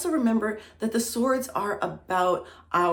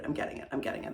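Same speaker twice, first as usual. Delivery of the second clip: teary murmur; 5 semitones lower, 9 dB quieter, much faster than usual. Real, not acted. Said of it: "I'm welling up".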